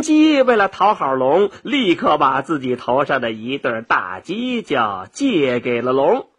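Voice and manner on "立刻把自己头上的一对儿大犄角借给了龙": plain reading voice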